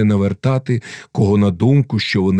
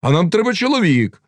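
raised, high-pitched objection; crowd calling out; 11 semitones higher than usual. A narrator's reading. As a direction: call out a demand to the assembly. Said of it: "загукали запорожці".

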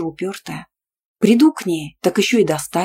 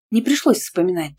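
ordinary delivery

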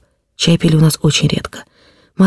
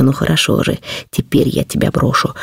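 hushed, lowered, bitter threat; frenzied whisper; 2 semitones lower, 3 dB quieter than usual. A narrator's reading. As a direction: drop into a menacing undertone. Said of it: "шептал он мне в каком-то исступлении".

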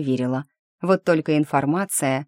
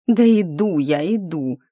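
grumbling; 2 semitones higher, 4 dB louder than usual. A narrator's reading. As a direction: grumble on the way to the door. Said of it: "проворчала я".

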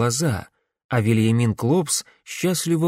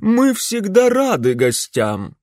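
much higher, louder, slow haughty lady; grande dame; 9 semitones higher, 4 dB louder, slower than usual. A narrator's reading. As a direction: greet guests like a pompous hostess. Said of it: "надменно произнесла она".

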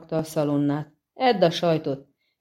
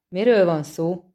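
plain reading voice

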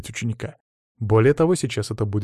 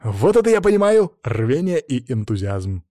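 grinning approval; 4 dB louder than usual. A narrator's reading. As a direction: praise them through a grin. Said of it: "осклабился я". (довольно, с ухмылкой)

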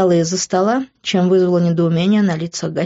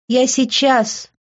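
higher, drawn-out reply, only mildly loud; loud answer; 6 semitones higher, much slower than usual. A narrator's reading. Woman answering a knock at the bathroom door, mildly loud drawn-out call. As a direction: call it out slowly, pitched up, without shouting. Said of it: "громко ответив".

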